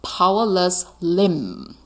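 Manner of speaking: slow, drawn-out reading aloud. Shown as a demonstration. Teacher reads slowly to students, drawn out. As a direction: read each word slowly for the class. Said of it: "grab his powerless".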